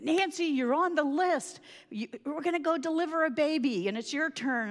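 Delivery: calling out. Retold or imitated. Driver shouting from the van to Nancy, loud. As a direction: call to her across the field.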